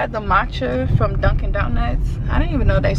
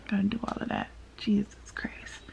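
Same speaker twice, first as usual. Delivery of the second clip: tired breathy whisper under her breath; 12 dB quieter than usual. Real, not acted. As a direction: sigh it out in a whisper. Tired sigh about glue removal.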